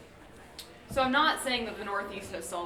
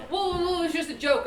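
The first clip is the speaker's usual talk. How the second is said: high-pitched imitation of a defensive joker; mock defensive backpedaling; 7 semitones higher, 3 dB louder, faster than usual. Joker backpedaling to offended friends, mock defensive. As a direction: hurriedly protest as if caught out, mockingly.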